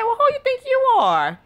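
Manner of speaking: high mock indignation; indignant. Mom challenges her grabby baby, indignant. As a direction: challenge him in comic outrage.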